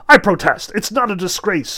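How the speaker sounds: loud indignant protest; shouting cat. A narrator's reading. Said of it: "shouted the cat".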